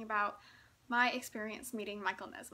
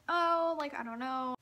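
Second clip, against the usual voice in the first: hesitant parents; 4 semitones higher, 6 dB louder than usual. Retold or imitated.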